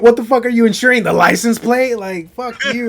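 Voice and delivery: loud, high-pitched indignant rant; mock outrage. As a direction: rail at the insurer for laughs. Friend joins rant at insurer.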